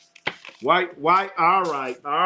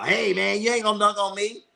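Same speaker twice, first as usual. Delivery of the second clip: lightly voiced old guy, quick and higher; mock old dude; 5 semitones higher, faster than usual. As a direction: tease like an old player in the gym. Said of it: "I'm one of them old dudes".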